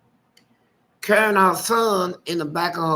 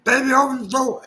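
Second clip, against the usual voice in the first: put-on higher, whiny drunk begging at the window; drunk husband pleading; 7 semitones higher, 4 dB louder than usual. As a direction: plead to be let in, slurring.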